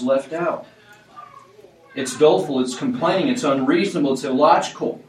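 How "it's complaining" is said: usual speaking voice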